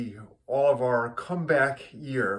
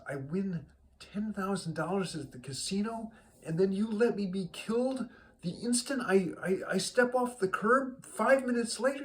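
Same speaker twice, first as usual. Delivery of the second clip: indignant complaint; 7 semitones higher than usual. Acted. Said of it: "Saul is angry".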